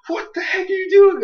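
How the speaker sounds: high, loud squabbling yell; mock angry yell. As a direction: shout it at your rival. Two Pokemon arguing, voiced.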